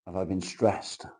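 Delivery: ordinary delivery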